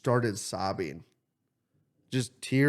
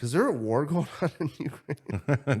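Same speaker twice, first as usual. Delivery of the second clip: giggling through the question; laughing question; 4 semitones higher than usual. Pretend. Speaker joking about asking the stranger, laughing question. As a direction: ask it while cracking up.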